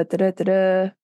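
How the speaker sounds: ordinary talk, nothing marked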